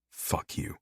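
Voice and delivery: harsh whispered fuck you; whispered curse. Acted. Brother hissing back at his sibling in a whisper. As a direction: spit it out under the breath.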